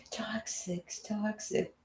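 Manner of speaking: high whispered giggle on toxic; whispered laughing. Real, not acted. Echoing toxic to the group, giggling whisper.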